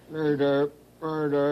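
lightly imitated frog call; frog croak. Woman copying the frogs' talk, light croak imitation. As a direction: try a gentle ribbit. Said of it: "That's the way they sometimes talk".

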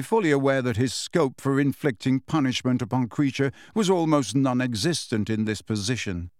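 usual speaking voice

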